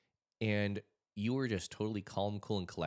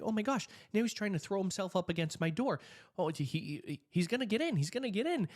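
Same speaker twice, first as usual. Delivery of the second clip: higher, louder terrified cry; panicked; 12 semitones higher, 4 dB louder than usual. Retold or imitated. Caller telling the dispatcher the man will get in, panicked. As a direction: sound like the door is about to give. Intruder at the door.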